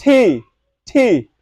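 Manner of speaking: টি said carefully twice, crisp t; correct T letter. Shown as a demonstration. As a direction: demonstrate টি slowly. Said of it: "অবশ্যই এটাকে বলতে হবে".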